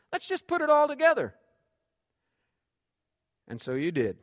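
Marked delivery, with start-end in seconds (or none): 0.1-1.3 lifted high, eager and coaxing; coaxing invitation. Acted. Neighbor lady urging the embarrassed guest to share, high coaxing voice. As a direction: sweep the guest into sharing.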